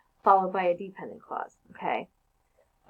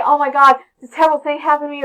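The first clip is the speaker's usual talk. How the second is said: loud, fast, higher gush of news; excited news; 5 semitones higher, 9 dB louder, faster than usual. Retold or imitated. Teacher imitating someone bursting with news, excited and fast. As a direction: blurt it out like you can't wait to tell. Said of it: "breathless and we have some exciting news to tell somebody".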